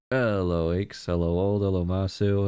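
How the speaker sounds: ordinary talk, nothing marked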